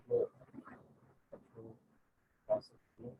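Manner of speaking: choppy connection dropping words; bad network audio cutting out; voice breaking up. Real, not acted. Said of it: "Your voice is breaking in between".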